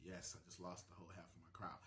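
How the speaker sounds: whispering; half-joking whispered aside. Real, not acted. Aside after a bold claim.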